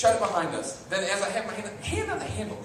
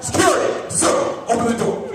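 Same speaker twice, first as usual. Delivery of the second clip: raised, authoritative mock guard call; security guard; 4 semitones higher, 6 dB louder than usual. Security guard pounding on a hotel door, loud mock command. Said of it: "the guy's now pounding on the door".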